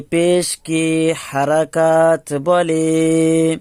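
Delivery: slow, drawn-out rote chant; slow recitation. Shown as a demonstration. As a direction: chant it slowly for students to memorize. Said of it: "এক কথা আমরা মুখস্থ করে নেই". (ধীরে ধীরে মুখস্থ করানোর সুর)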